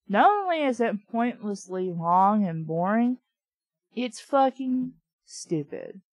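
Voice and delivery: usual speaking voice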